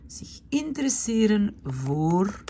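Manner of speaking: Zich interesseren voor said aloud, slow and clear; clear slow repeat. Shown as a demonstration. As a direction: say it again clearly for the class. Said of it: "repeats the verb clearly".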